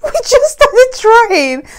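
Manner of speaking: high, loud, slow astonishment; husband amazed it happened so soon; amazed disbelief. Retold or imitated. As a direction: marvel at how fast it happened. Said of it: "He was like".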